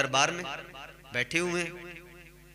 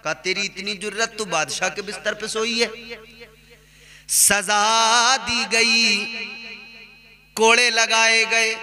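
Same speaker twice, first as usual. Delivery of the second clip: slow, raised sermon delivery; preaching storyteller; 6 semitones higher, 5 dB louder, slower than usual. Acted. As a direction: build the tale like a preacher from the pulpit. Preacher tells story with force.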